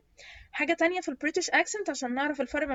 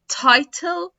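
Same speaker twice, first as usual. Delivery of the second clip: louder, higher British "title" with real T; British clear T; 5 semitones higher, 8 dB louder than usual. Shown as a demonstration. Teacher contrasting with American D, British clear T. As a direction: say title as "tie-tel". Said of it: "في ال British accent".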